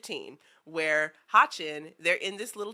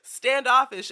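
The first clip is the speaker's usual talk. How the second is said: ordinary delivery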